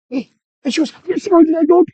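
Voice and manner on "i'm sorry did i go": high, loud retold exclamation; raised, high-pitched put-on sentiment; mock emotional gushing. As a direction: pitch it up and gush, play-acting. Acted emotional exchange with shy Shannon.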